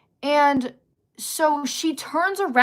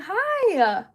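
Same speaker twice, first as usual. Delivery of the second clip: a higher, sheepish hi; awkward laughed-off hi; 8 semitones higher than usual. Retold or imitated. Retelling greeting the gossiping girl, awkward laughed-off hi. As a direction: laugh it off with a quick hi. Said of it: "I kinda just laughed it off".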